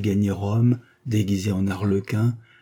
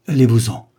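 louder urgent cry; cried out; 3 semitones higher, 8 dB louder than usual. Acted. Count urging friends to flee, a loud cry. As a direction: order them out, loud and urgent. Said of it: "il cria".

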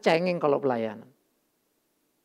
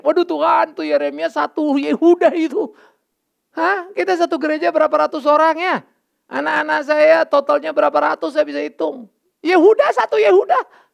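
higher, louder, pleading with God; loud incredulous outcry to God; exasperated cry to God; 9 semitones higher, 8 dB louder than usual. Retold or imitated.

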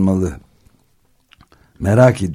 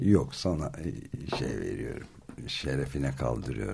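hushed mock toast; 5 semitones lower, 11 dB quieter than usual.

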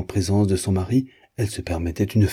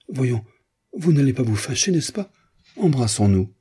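put-on high, childlike coaxing; timid giantess; 6 semitones higher than usual. A narrator's reading. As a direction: coax shyly for a hug. Giantess timidly asks for a kiss. (voix aiguë, timide et câline)

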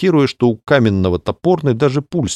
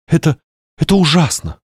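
faltering dismay; 8 semitones higher than usual. Acted. Clarissa faltering.

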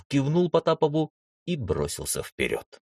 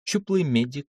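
plain reading voice

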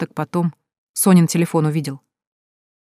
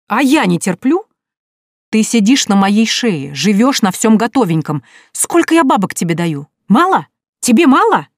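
angry husband; 5 semitones higher, 5 dB louder than usual. A narrator's reading. Husband yells back in quarrel.

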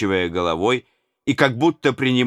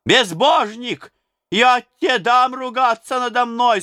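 high, loud, slow furious scolding; old nurse shouting back at the tsar; angry old woman shouting; 4 dB louder than usual. A narrator's reading. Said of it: "закричала она на Иоанна".

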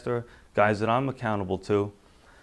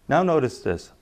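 normal talking voice

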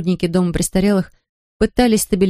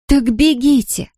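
shouting; 6 semitones higher, slower than usual. A narrator's reading. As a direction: cry out to send her running. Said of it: "вскричала Киви".

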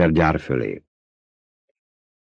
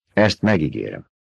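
understated, slightly lowered vow; emotionless; 3 semitones lower than usual. A narrator's reading. Duke promising payback for the loss, emotionless and restrained. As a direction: promise it without any feeling showing. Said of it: "érzelemmentes volt az apja hangja".

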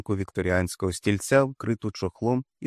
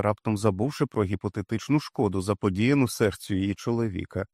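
usual speaking voice

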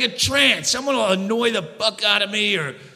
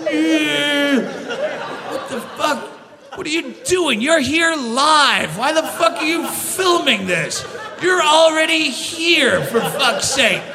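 incredulous outburst; 4 semitones higher, slower than usual. Acted. Heckling a fan filming.

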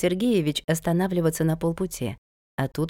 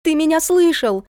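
high, loud demanding shout; sharply raised, insistent voice; 11 semitones higher, 5 dB louder than usual. A narrator's reading. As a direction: yell it at a child who won't listen. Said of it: "закричала она".

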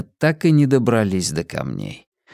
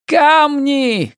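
high, drawn-out urgent shout; 4 dB louder than usual. A narrator's reading. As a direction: yell a warning high and slow. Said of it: "кричал Ки". (протяжный высокий крик)

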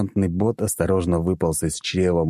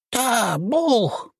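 very high bouncy creature voice; squeaky jumping little creature; small creature voice. A narrator's reading. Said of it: "подпрыгнул зелёный Тоби".

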